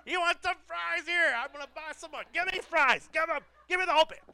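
hammed-up greedy voice; greedy flesh voice. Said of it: "So my flesh wants to do".

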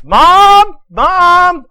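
put-on high, loud kid's holler; yelling for Mom. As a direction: shout far up in pitch, like an insistent child. Boy yells for mother again.

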